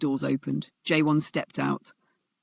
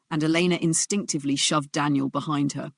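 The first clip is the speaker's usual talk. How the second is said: ordinary delivery, nothing marked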